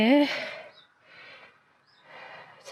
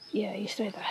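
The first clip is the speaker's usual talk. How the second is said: whispering; 10 dB quieter than usual. Real, not acted. Talking to bike, whispered.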